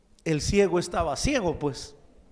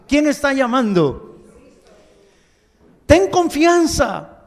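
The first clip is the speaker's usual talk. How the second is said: high, loud punched points; loud fervent sermon climax; preaching at full force; 5 semitones higher, 7 dB louder than usual. Acted. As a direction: hammer each point home.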